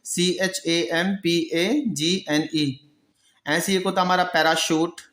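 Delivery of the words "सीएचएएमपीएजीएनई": सीएचएएमपीएजीएनई spelled slowly letter by letter; spelling out letters. Shown as a demonstration. Teacher dictating the champagne spelling, slow. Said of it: "spelling क्या होगी?".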